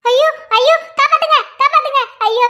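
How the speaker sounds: frightened, repeated wailing for rescue; panicked crying out. Acted. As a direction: call out for mother in panic. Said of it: "கத்தாத".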